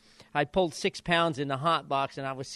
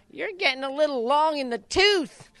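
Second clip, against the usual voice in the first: much higher mocking imitation of the chief; needling trainer; 11 semitones higher, 5 dB louder than usual. Trainer teasing the jockey after a loss, much higher squeaky mimic. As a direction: jab at him in a squeaky teasing voice.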